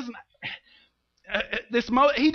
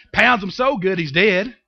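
ordinary delivery

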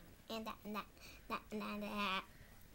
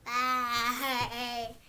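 bye with a laugh; 12 dB louder than usual. Real, not acted. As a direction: laugh out the goodbye. Laughing goodbye to camera.

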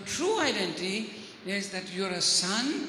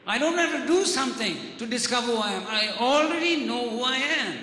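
firm self-assurance. Acted. Jesus answering temptation about his identity, firmly self-assured. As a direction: affirm it forcefully, each statement landing firmly. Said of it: "Jesus says to himself".

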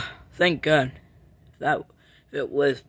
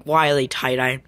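normal talking voice

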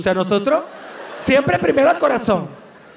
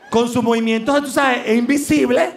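normal talking voice

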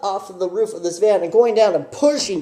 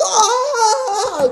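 high, laughing mock yelp; shocked yelp. The driver reacting to little Alex, high laughing yelp. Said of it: "looking at little Alex like".

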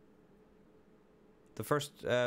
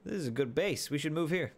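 brighter, higher repeat; excited; 6 semitones higher than usual. Real, not acted.

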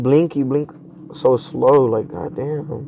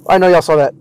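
scared horror game streamer; 4 semitones higher, 6 dB louder, faster than usual. Real, not acted. Just saw something scary.

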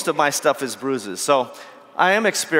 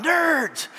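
much higher hammed-up movie cry; mock Star Trek cry; 10 semitones higher than usual. Speaker hams up a movie cry at his rival. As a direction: ham it like a film villain's roar. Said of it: "kinda like Star Trek two, Wrath of Khan".

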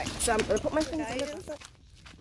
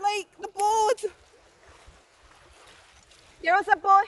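raised, higher voice asking across the water; calling out; 10 semitones higher, 5 dB louder than usual. Real, not acted. Left behind by the dive boat, calling loud to boatmen. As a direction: keep hailing the boatmen, loud and pleading.

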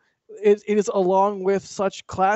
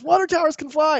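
higher, louder awestruck quote; imitating excited Tim; 9 semitones higher, 7 dB louder than usual. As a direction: echo Tim's amazed outburst. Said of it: "And then Tim says".

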